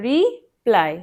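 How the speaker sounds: রিপ্লাই said slowly in two parts; split into syllables. Shown as a demonstration. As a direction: draw out রি, then প্লাই. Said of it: "দুটো ভাগে বলছি".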